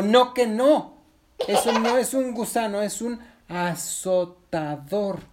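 high, loud refusal slowing at the end; insist, then spell out the danger slowly; forceful high character voice; emphatic warning. Acted. Resbalardilla warns firmly.

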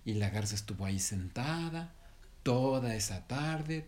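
ordinary delivery

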